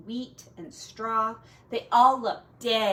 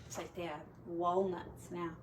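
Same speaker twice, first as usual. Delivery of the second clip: hushed comment; quiet aside; 5 semitones lower, 11 dB quieter than usual. Real, not acted. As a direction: softly point it out. Hushed aside about picture.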